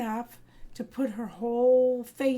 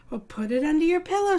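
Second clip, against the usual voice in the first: quick matter-of-fact instruction; brisk mom; 4 semitones higher, 4 dB louder, faster than usual. Acted. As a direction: hurry the kid to bed. Mom brisk bedtime reply.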